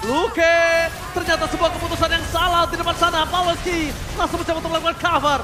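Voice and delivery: fast, rising play-by-play; esports caster. Acted. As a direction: rattle off the action quickly.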